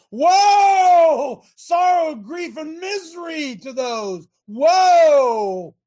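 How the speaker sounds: high, loud proclaiming cry of woe; preaching shout. Shown as a demonstration. Preacher to the audience, shouting a prophet's woe. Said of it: "Woe is sorrow or grief or misery".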